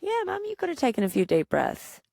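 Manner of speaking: put-on high little-kid echo; child's voice. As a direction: play the child agreeing with mum. Child echoing mum's words.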